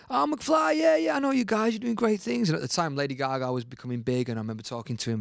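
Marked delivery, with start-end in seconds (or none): 0.1-2.5 high-pitched, gushing star impression. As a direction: imitate a star greeting a young band. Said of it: "so he goes".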